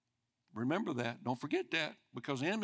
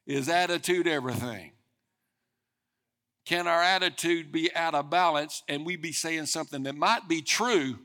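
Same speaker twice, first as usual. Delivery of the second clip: louder, driving preacher's cry; fervent pulpit delivery, louder and rising; full-force preaching; 3 semitones higher, 7 dB louder than usual. Acted. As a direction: press each question on the congregation.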